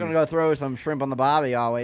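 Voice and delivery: put-on Australian accent. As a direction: ham up an Aussie mate.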